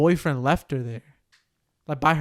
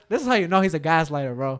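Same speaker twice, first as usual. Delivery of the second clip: ordinary talk, nothing marked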